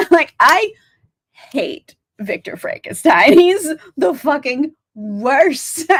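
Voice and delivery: emphatic, drawn-out ranting with laughs; laughing exasperation. Real, not acted. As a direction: lean hard into the hatred, half laughing. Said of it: "Frankenstein also enrages me".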